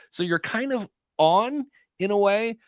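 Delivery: normal talking voice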